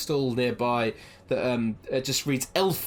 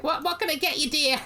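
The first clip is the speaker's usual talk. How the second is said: high sweet gnome shopkeeper; gnome woman voice. Acted. Gnome woman serves Blossom, high sweet voice. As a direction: coo it like a kindly gnome granny. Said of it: "It's like".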